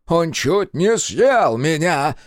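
high, loud, drawn-out complaint; complaining. A narrator's reading. Rescued commander to his rescuers, complaining. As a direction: complain slowly at a high pitch. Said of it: "пожаловался Интернационалов".

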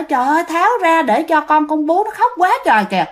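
mother pleading to be untied so she can nurse; urgent pleading mother. Retold or imitated. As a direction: beg them to free her for the crying baby. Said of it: "mợ nói".

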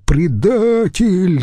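high, drawn-out cry of accusation; outraged yell. A narrator's reading. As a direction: hurl it out as an accusation, stretched. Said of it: "заорал он". (возмущённый крик)

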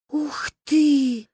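high breathy wow; amazed gasp. A narrator's reading. Girls gasp in wonder at the braid. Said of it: "выдохнули мы".

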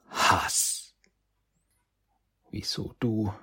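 eerie unvoiced demon whisper; demonic whisper. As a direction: hiss it like a voice in the head. Demon voice whispers in his head.

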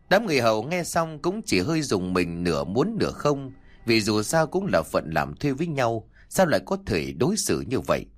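usual speaking voice

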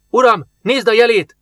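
high, loud, startled call; urgent alarm. A narrator's reading. Foreign guard points out the emblem to his master, alarmed. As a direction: cry out a warning to your master.

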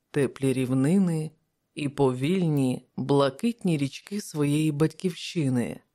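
ordinary delivery

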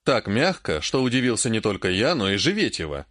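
ordinary delivery

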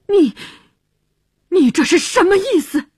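high, indignant stammered demand; very annoyed. A narrator's reading. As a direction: bristle and demand an explanation. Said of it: "克里斯非常气恼".

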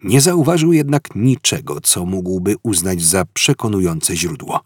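ordinary delivery, nothing marked

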